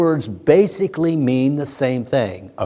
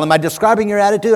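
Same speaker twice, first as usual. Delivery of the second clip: usual speaking voice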